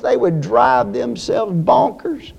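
normal talking voice

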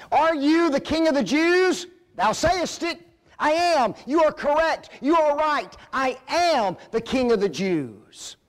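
high, forceful preacher's dramatisation; acted exchange. Preacher dramatising Pilate's question and Jesus' bold answer. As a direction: act out both voices with fervour.

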